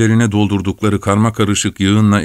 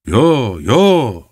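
two raised, drawn-out cries of protest; narrator's shouted refusal; 3 semitones higher than usual. A narrator's reading. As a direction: cry out in protest, pitch swooping up. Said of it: "diye bağırdı".

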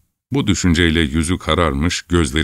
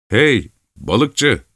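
higher taunting call; 6 semitones higher than usual. A narrator's reading. Lad calling out to get back at Chelkash, taunting. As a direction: shout across to needle him. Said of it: "diye bağırdı".

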